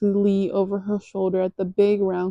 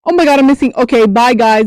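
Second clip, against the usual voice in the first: startled rush; 4 semitones higher, 10 dB louder, faster than usual. Real, not acted. Caught off guard by someone.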